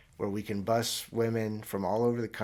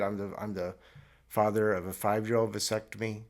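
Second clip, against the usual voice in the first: ordinary delivery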